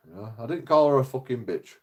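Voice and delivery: ordinary talk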